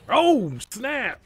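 high, loud exclamation; surprised. Real, not acted. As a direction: blurt it out in surprise. Surprise in game.

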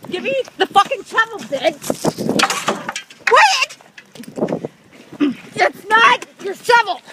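furious high-pitched yelling; angry yelling. Real, not acted. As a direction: scream at them for the shovel. Screaming at neighbours over shovel.

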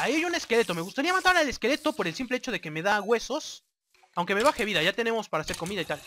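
ordinary talk

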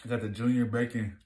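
chewing while talking; talking between bites of burger; mouth full. Real, not acted. Seen 0: holds burger, lips pressed, mouth seems full after bite.

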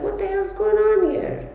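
high, bewildered inner-voice exclamation; baffled outburst. Retold or imitated. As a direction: squeak it out, baffled. Retelling her puzzled reaction.

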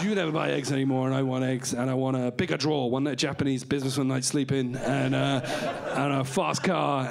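low, surly whisk character; whisk hostage-taker. Acted. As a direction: list demands as a sulky whisk. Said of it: "I'm a whisk on the edge".

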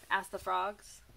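ordinary delivery

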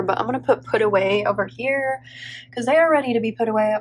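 ordinary talk

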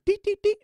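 high mock beeping; menu beeps. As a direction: beep like a game menu, high.

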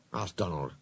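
usual speaking voice

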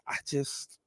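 a sigh into a whisper; deflated whispered trailing off. Real, not acted. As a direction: whisper it, worn out. Exasperated aside.

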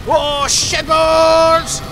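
high, loud, very slow "Oh, shit balls"; a real shout of delight; stretched victory shout. Real, not acted. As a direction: draw it out in exultation. Exultant exclamation at big hit.

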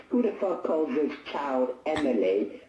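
ordinary talk

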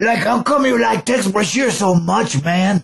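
Chicano stoner voice; puzzled stoner asking a pal; Cheech. Acted.

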